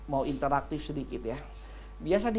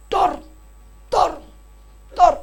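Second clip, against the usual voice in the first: higher, louder pistol shots; gunshot sound; 12 semitones higher, 10 dB louder, slower than usual. Retold or imitated. Pastor imitating a man's pistol shots, loud pops. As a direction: pop out each shot like a pistol. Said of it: "Kalau nembak".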